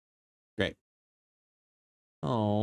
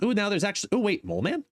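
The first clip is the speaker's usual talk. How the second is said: surprised; 10 semitones higher, faster than usual. Real, not acted. Spotting a new variant.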